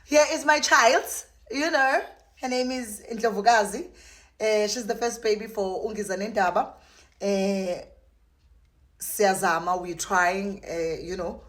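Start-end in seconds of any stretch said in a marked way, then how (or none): 0.1-6.7 proud presenting. Real, not acted.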